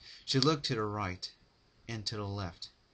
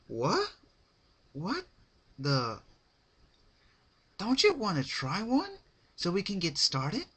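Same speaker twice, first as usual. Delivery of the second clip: high, loud and eager pony; high excited party pony pressing a treat; bubbly Pinkie voice; 10 semitones higher, 5 dB louder than usual. Acted. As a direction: push the cupcake on her cheerfully.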